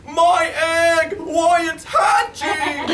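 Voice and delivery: high, loud, slowing excited shout. A narrator's reading. Horton watching his egg hatch, high loud shout. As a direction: cry it out with growing amazement. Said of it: "shouted Horton".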